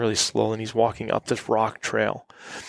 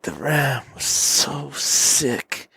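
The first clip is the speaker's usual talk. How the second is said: higher, very slow mock storytelling; imitated charming, drawn-out persuasion; Vasily; 4 semitones higher, much slower than usual. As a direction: stretch it out like a guide acting a tale. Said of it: "he just looked so charming telling his story".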